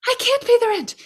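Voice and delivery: very high pleading damsel voice; damsel in distress. Pretend. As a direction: squeal it like a helpless victim. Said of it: "this is the world's shortest play".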